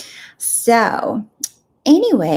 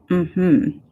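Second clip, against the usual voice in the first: slow, dismissive mm-hmm, fading out; sarcastic mm-hmm; much slower than usual. Retold or imitated. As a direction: brush it off with mock politeness. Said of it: "It's like".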